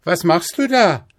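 put-on high, loud child calling up; rufend. A narrator's reading. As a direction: call up to someone high above. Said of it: "rief der kleine Häwelmann hinauf". (hoch und laut hinaufgerufen)

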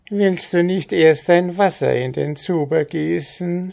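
high, sly wheedling goblin voice; cunning goblin. A narrator's reading. Goblin slyly urges the farmer to pour the water, high and wheedling. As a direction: coax him with false kindness. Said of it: "ein arglistiges Lächeln".

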